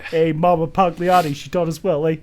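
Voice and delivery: loud Jersey wiseguy; New Jersey mobster banter; Jersey accent. Pretend. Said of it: "we do the Jersey accent".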